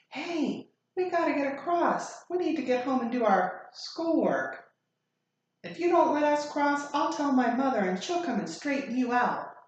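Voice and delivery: high, loud whining schoolboy; loud high-pitched bratty threat; bully's whiny demand. Acted. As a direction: demand to cross, high and pushy. Bully whining at crossing guard.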